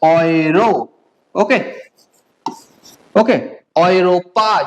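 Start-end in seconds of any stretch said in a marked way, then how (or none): 0.0-0.7 ऑयरो slow, as "oy-ro"; ऑयरो with "oy" opening and long final o; German EU example. Shown as a demonstration. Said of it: "क्या बोला जाता है". 3.8-4.6 औरोपा as "oy-ro-paa", last syllable held; lower-pitched औरोपा with long final "paa"; German Europa. Shown as a demonstration. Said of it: "Europe को क्या बोला जाता है".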